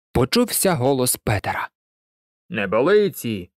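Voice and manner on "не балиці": low, mildly stern dad voice; father calling out. A narrator's reading. Dad rebukes his son over the tale, low fatherly call. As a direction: drop the pitch for a hint of a father. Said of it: "гукнув його тато".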